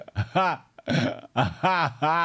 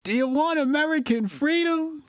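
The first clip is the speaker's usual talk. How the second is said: higher, mock-rally quoting; mock grandstanding; 9 semitones higher than usual. Reactor mimicking the streamer's rally question. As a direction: echo the rally cry in a raised voice. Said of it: "What could he possibly say after that?".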